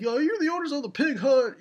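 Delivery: put-on kid voice. Acting a kid addressing the owners.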